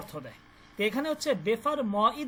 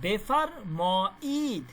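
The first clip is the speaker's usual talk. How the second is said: slow model phrase; much slower than usual. Shown as a demonstration. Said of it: "এটা হচ্ছে একটা".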